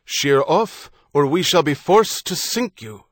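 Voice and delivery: loud naval order through a hailer; shouted command. A narrator's reading. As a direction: bark the warning across the water. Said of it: "as the rating repeated his command".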